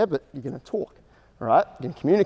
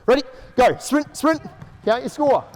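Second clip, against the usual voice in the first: coaching shout; 10 semitones higher, slower than usual. Real, not acted. Coach to players mid-drill, shouting encouragement. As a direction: drive the players on from the sideline.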